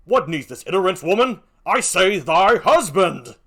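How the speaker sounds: loud, slow snap of irritation; impatient insistence. Acted. Othello scolds Emilia for repeating, impatient. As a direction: hammer the last words home.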